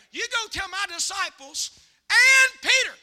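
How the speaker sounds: emphatic, rising to a loud high finish; voicing Jesus. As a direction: punch the last words out to the congregation. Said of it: "He said this to them".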